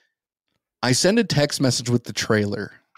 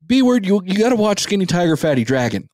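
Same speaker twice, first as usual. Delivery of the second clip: higher, quicker imitated pestering; mock a pushy brother, pitched up and insistent; nagging brother; 8 semitones higher than usual. Co-host imitating his friend's brother's pestering, high and nagging. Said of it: "I also hear from your brother".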